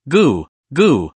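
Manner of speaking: ordinary delivery, nothing marked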